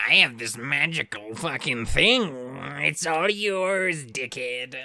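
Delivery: hammed-up, drawn-out mock offer, loud and high; shady random stranger. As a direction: stretch it out like a suspicious peddler. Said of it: "this random dick is who's like".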